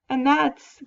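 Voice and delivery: normal talking voice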